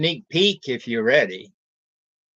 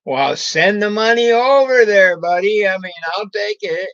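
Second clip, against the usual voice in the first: playful; 6 semitones higher, 6 dB louder than usual. Real, not acted. Joking about viewers sending money.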